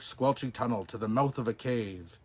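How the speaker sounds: ordinary delivery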